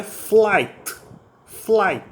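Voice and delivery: "flight" with silent gh and t; English "flight". Shown as a demonstration. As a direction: say "flight" rhyming with light, gh silent. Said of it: "você pronuncia simplesmente flight". ("flight" sem pronunciar G nem H)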